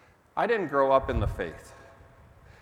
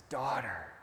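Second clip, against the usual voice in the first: put-on soft, tender naming of a child; 6 dB quieter than usual. Jesus gently calling the healed woman his daughter, soft and tender. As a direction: say it quietly like to your own girl. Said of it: "He says".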